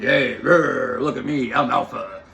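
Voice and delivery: loud, growling mock-alpha boast. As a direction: puff up and roar like a show-off. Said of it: "to assert their dominance".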